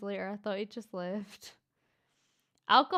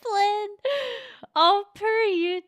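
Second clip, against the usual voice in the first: 1 excited squeal; 10 semitones higher, 10 dB louder than usual. Real, not acted. Gushing reaction to donation.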